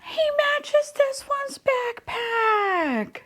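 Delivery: stretched-out, very high squeal; delighted squeal. Real, not acted. Unboxer seeing two toys' backpacks match, drawn-out high squeal. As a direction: squeak it slowly, overcome by cuteness.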